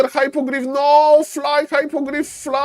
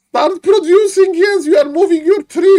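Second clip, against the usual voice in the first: urging shout; 7 semitones higher, 5 dB louder than usual. Acted. Caster urging player again.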